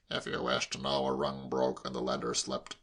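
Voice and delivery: low, subdued grumpy voice; grudging uncle. A narrator's reading. Uncle explaining to nosy nephew, grudging. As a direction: explain grudgingly, pitched well down.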